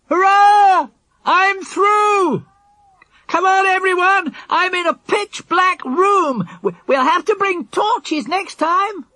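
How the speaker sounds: high, triumphant yell; yelling back. A narrator's reading. Jack to the others from inside, yelling back. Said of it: "He yelled back".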